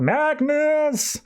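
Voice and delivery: high-pitched mock yell, no real volume; retold yell. General yells out on finding the holograms, high mock yell. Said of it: "General even yells out".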